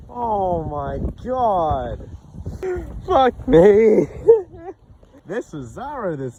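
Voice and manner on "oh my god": normal talking voice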